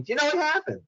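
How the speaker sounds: mock yell up high at a half-deaf man; shouting up high so he can hear; screaming. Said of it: "they start screaming".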